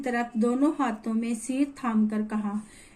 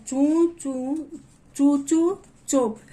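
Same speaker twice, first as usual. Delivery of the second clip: mock shushing; 3 semitones higher, much slower than usual. Child mockingly hushing father.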